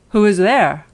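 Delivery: loud, booming wolf at the door; wolf in grandmother's bed answering the knock; big wolf voice. Acted. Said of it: "heard the big voice of the wolf".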